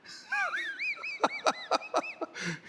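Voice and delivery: huge high theatrical cackle; evil villain cackle. Pretend. Villain laugh after taunt.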